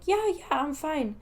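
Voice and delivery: high chirpy I'm fine to a worried stranger; forced reassurance. Retold or imitated. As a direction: insist you're fine a bit too brightly. Brushing off dog owner's concern.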